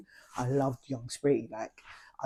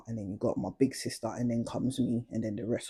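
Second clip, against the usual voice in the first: ordinary talk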